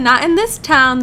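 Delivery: ordinary talk, nothing marked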